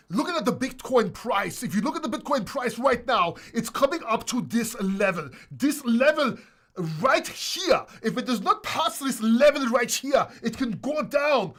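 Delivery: high, loud, hammed-up foreign accent; mock the YouTuber's accent, big and loud; loud teasing accent impression of a crypto YouTuber; imitating his accent. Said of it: "I love his accent".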